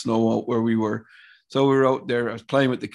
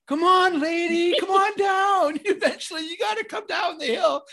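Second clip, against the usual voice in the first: put-on cheering little kids. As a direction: egg her on like a pack of small kids. Little kids cheering from hill bottom.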